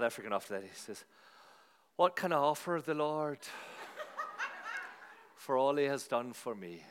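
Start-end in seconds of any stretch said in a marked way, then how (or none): 2.0-6.8 a lightly attempted Irish accent, with a laugh; playful half-hearted Irish reading of the psalm. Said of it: "I can give it a bash in Irish".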